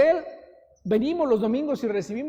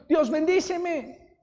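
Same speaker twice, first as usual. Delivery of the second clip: Jacob begging; 6 semitones higher, 4 dB louder, much slower than usual. Retold or imitated. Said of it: "se aferra con Dios y le dice".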